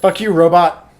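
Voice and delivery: loud playful insult; mock angry. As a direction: yell it at the robot. Yelling at the robot phone.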